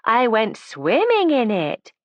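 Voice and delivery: slow, drawn-out sarcastic reply; high and slow, dripping with sarcasm; elaborate sarcasm. A narrator's reading. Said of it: "she said with elaborate sarcasm".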